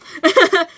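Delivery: short embarrassed giggle. Real, not acted. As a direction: laugh it off after the crush. Laughs after naming [name].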